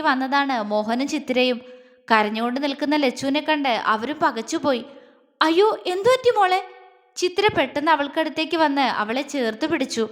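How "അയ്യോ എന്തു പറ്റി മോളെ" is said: high-pitched, anxious cry of concern; motherly alarm. A narrator's reading. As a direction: gasp out the worried question. Mother alarmed at crying girl.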